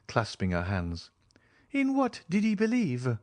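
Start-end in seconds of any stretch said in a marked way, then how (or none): 1.7-3.2 put-on high, earnest young woman's voice; pleading young woman. Julie presses the count, high and earnest. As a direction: press him for an answer.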